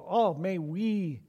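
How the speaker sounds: ordinary talk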